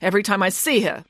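high-pitched, self-important declaration; grandiose nanny, resolved. Acted. Nanny announcing she will compete, grandly resolved.

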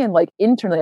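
normal talking voice

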